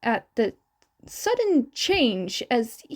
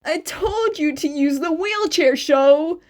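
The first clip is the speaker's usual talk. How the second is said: exasperated scolding; 4 semitones higher, 5 dB louder than usual. Acted. Scolding Shota who fell.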